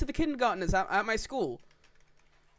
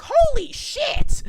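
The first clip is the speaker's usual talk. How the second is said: high, slow, stretched-out amazement; squealing a drawn-out holy shit; amazed; 9 dB louder, much slower than usual. Retold or imitated. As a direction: gasp it out in drawn-out wonder. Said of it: "I was like".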